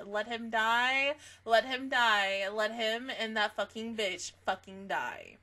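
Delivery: slow, spaced-out insistence; slow, deliberate. Real, not acted. Fan insisting to viewers the villain must die, slow. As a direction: drag each word out. Repeating a wish.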